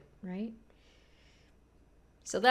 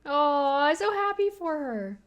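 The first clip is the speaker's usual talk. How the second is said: gushing; 8 semitones higher, 6 dB louder, slower than usual. Real, not acted. Gushing over the show.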